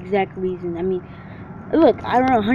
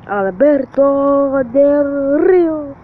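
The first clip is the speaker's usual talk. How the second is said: mock announcer call; 5 semitones higher, 5 dB louder, much slower than usual. Calls name like announcer.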